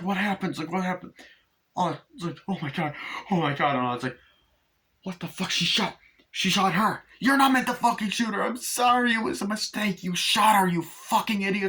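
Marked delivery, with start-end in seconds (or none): 0.0-3.8 high-pitched alarmed questions; mock panic. Film family finding the girl shot, mock panic. Panic after accidental shooting. 5.0-11.7 high-pitched frantic scolding; acted outrage. Family quarrelling over who got shot, acted outrage.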